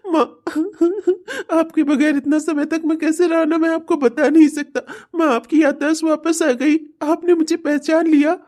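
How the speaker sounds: high, crying with relief; tearful joy. Acted. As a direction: weep happily to his mother. Son's tearful joy at recognition.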